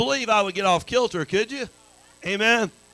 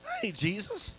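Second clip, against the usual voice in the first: high, soft put-on voice for Peter; hammed-up Peter correcting a confused saint; mock Peter; 9 semitones higher, 9 dB quieter than usual. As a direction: squeak it out like a puzzled Peter. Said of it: "Peter's gonna say".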